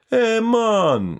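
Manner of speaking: sketch title sung out high in a hammed-up Jamaican accent; mock Jamaican accent. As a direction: leap way up and drawl it like a bad Jamaican impression. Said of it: "forgive him the terrible Jamaican accent".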